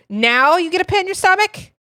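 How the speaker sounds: loud, high, rising disbelief; incredulous. Real, not acted. Streamer talking back to the game's narrator, loudly incredulous. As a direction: throw it back as an outraged question.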